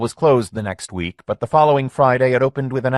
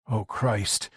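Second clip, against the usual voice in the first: soft, stunned whisper; Lenny whispering after the crash; 7 dB quieter than usual. A narrator's reading. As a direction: breathe it out in shock. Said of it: "whispered Lenny".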